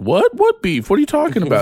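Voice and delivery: high-pitched mock innocent surprise; feigned bafflement. Rival denying they have beef, feigned bafflement.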